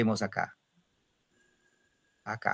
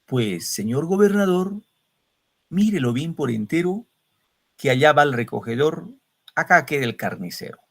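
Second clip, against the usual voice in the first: reciting a colonial couplet aloud; rhythmic poetry recital; recited verse; 3 semitones higher than usual. Shown as a demonstration. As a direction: declaim it like an old poem. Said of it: "Esa copla dice así".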